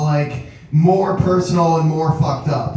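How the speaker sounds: ordinary delivery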